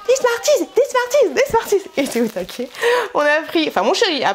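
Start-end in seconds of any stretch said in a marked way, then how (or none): 0.1-1.9 put-on high excited chant of a kid in the shop; imitating Choki begging. Retelling how Choki begged for Smarties in the shop, high chanting imitation. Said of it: "Il était comme ça dans le magasin".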